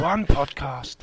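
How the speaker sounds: slow, dramatic trailer announcer; mock trailer narrator, drawn out; movie trailer voice. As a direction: stretch each word like an epic trailer. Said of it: "that's what I was going for".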